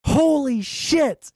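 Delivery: normal talking voice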